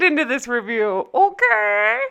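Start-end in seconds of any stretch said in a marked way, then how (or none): 1.1-2.0 very high, exaggerated European accent; muddled put-on accent. Recapper plugging her Patreon, high muddled mock accent. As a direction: plug it in a high, silly, unplaceable foreign accent. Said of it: "what accent I'm doing now".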